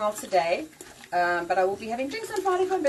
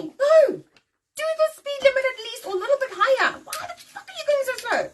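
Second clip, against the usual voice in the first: road rage; 7 dB louder than usual. Retold or imitated. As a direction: scream it out, stuck behind a crawler. Ranting at slow drivers.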